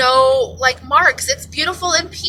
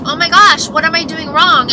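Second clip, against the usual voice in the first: imitating her own exasperation, louder and higher; mock exasperation; 5 semitones higher, 6 dB louder than usual. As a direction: blurt it out in exaggerated despair. Retelling her frustration over portions.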